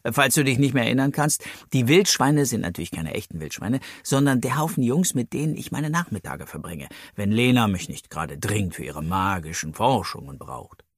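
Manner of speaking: ordinary delivery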